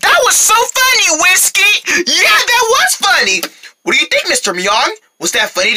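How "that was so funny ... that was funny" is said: put-on high, giddy kid voice; gushing praise. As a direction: squeal with delight at the joke. Kids praise Whiskey's joke.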